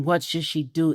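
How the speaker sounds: ordinary talk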